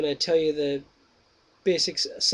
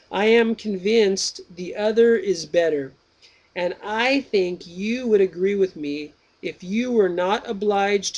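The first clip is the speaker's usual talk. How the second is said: slow, raised reading voice for the dialogue; dramatic reading; 3 semitones higher, 4 dB louder, slower than usual. Acted. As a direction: read the old text out slowly and grandly. Reads Plato dialogue aloud.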